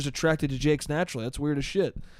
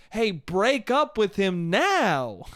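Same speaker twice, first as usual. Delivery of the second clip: slow, drawn-out command; hammed-up plea, stretching the final word; mock urging; 3 semitones higher, much slower than usual. Telling the girl next time, mock urging. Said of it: "look at the girl and go".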